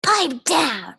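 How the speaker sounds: loud, snapping command; stern order. Acted. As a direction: snap at them. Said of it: "said the swamp".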